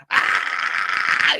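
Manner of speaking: a short, toneless, airy mock scream; imitating Jesse's scream. Retelling Jesse seeing his hand, a breathy mock scream. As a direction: rip out a breathy little horror-movie yelp. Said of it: "But Jesse's scream".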